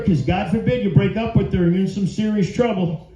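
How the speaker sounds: ordinary delivery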